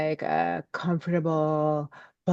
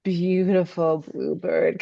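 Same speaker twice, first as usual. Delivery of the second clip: ordinary talk, nothing marked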